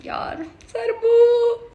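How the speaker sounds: a high, slow, tearful cry. Real, not acted. Crying for the hero, high and slow. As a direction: wail his name. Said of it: "कैसे रो रहा है".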